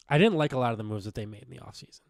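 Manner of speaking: usual speaking voice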